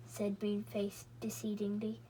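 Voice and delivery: whispered, slow tag line; whispered narration. Acted. Narrator slips into a whisper for Moonface's tag. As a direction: breathe the words out without voice. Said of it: "Deci-, decidedly".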